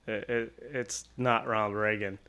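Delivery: soft, low, halting stammer; timid correction. Retold or imitated. Artist carefully correcting a customer, timid and quiet. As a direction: correct them quietly, hesitantly.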